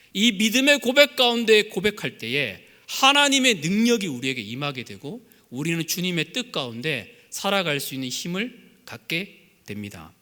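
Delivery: normal talking voice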